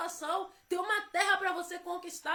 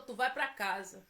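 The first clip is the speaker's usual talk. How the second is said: lower, calm voice of God; God's voice; 7 semitones lower than usual. Retold or imitated. God telling the preacher to go home, low calm command. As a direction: give the command low and settled. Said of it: "Deus falou".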